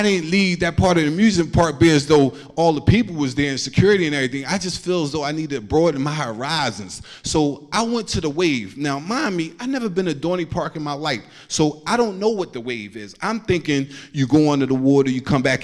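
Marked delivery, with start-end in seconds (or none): none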